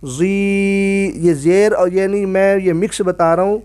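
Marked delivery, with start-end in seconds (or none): 0.0-1.1 زی held long as zeeee. Shown as a demonstration. Teacher demonstrates the stretched syllable. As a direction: stretch زی's vowel. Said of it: "زو زیر یا".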